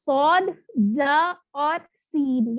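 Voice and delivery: صاد، زا اور سین spoken slowly and clearly; name each letter slowly for the class. Shown as a demonstration. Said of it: "وہ کون کون سے ہیں".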